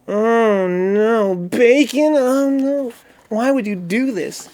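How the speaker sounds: high, stretched-out mock despair; mock anguish. Streamer overwhelmed by bacon on pizza, mock anguish.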